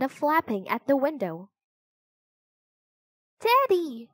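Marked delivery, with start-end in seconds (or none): 3.4-4.1 high, excited cry of a name. A narrator's reading. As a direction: cry out high, calling a friend. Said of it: "cried Annie".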